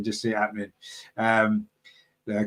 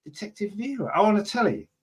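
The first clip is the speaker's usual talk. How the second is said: raised, baffled questioning; puzzled host repeats a listener's comparison; puzzled surprise; 7 semitones higher than usual. Real, not acted. Host querying a listener's comparison, surprised and high.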